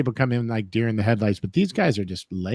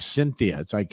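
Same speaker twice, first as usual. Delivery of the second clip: ordinary talk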